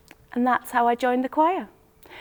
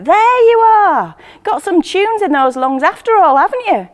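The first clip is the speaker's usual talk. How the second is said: loud, high shout calling up from the street below; 8 semitones higher, 8 dB louder than usual. Acted. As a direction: call up to a rooftop with delight. Friend calling up to roof.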